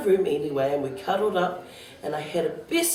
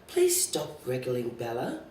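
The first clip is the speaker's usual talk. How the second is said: softer, slower weary mother; tired mother; 5 semitones lower, 6 dB quieter than usual. A narrator's reading. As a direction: ask wearily like a tired mum. Mother scolds child.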